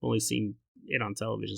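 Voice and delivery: ordinary delivery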